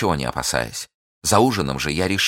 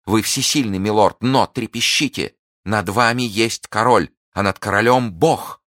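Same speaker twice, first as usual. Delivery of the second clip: louder, rising defiant warning; defiant threat; 4 semitones higher, 4 dB louder than usual. Acted. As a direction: threaten him with the king and God. Milady threatens her captor.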